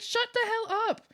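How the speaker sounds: high, drawn-out exasperated wail; slow, hammed-up furious yell; re-enacted angry yelling. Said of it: "I kept yelling".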